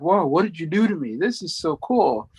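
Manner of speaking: put-on a kid marveling, lifted pitch; awed delight. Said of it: "He's like".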